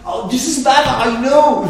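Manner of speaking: high, loud put-on voice of God; God's weary voice. God conceding to Moses about the guy, high loud put-on voice.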